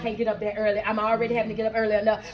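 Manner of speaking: fast, low grumbling excuses; reluctant excuse-making. Acted. Client balking at coach's early morning routine, grumbling.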